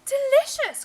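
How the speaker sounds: very high, loud delight; delighted cry. Acted. Mother smelling the music, delighted cry. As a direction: cry it out in rapture. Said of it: "cried his mother".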